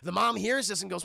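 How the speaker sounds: ordinary talk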